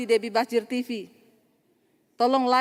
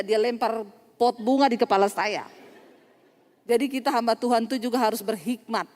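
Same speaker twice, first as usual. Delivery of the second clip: normal talking voice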